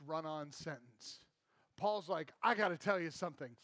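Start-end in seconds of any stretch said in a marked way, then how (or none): 2.4-3.6 fast, eager burst as Paul; lightly voiced Paul rushing to tell; rushed Paul. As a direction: hurry it out in one breath. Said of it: "Takes all the air and just blah".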